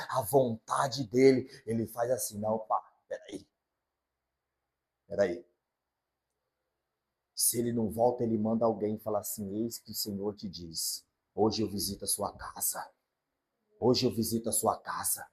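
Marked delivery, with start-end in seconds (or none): 2.4-5.5 soft voice of God. Acted. God stops for the heart. 9.6-15.3 soft prophetic word. Acted. Messenger delivers God's word.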